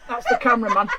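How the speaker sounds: ordinary talk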